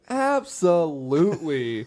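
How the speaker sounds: slow, smug, stretched-out "Absolutely"; a cocky, unhurried yes; drawn-out confident answer. Retold or imitated. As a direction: savour the word like it's obvious. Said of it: "He looks around and goes".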